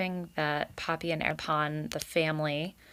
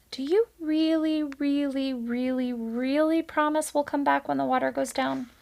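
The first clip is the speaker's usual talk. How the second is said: high, anxious little voice stretching each really; a child begging for a promise; pleading child; 8 semitones higher than usual. A narrator's reading. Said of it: "And a fourth".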